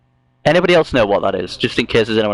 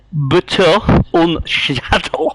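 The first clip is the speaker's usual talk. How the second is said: slow, higher-pitched stab at German; attempted German accent; 5 semitones higher, much slower than usual. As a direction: read it out in clumsy German, word by word. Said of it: "say this thing in German".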